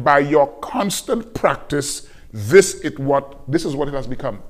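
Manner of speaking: constant drawn out slowly; preacher slowing to unpack the Greek; slow emphatic paraphrase. Acted. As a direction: dwell on each word of the meaning.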